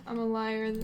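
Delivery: usual speaking voice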